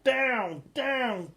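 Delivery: ordinary delivery, nothing marked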